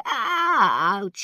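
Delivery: loud, high yelp; pained yelp. A narrator's reading. Old Khan struck by pain, loud yelp. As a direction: yelp as pain strikes mid-sentence. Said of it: "he yelped".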